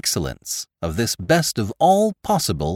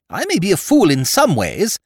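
indignant; 9 semitones higher, 4 dB louder than usual. Acted. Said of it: "Wrath is good for the complexion".

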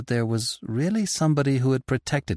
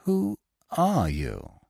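higher, slow insistent ask; repeated question; 7 semitones higher, 3 dB quieter, much slower than usual. A narrator's reading. Lupin pressing the stranger again, slow and higher. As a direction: draw the question out, pleading. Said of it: "he repeated".

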